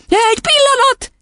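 squeaky-high plea; panicked cry. A narrator's reading. Confused boy stalling the headmistress, crying out. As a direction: beg for a moment. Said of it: "kiáltott".

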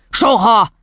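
high-pitched, brusque dismissal; cross dad's exclamation; angry father. A narrator's reading. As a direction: cut the child off with finality. Father's harsh final answer.

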